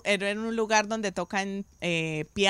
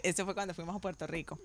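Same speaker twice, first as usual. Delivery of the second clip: ordinary talk, nothing marked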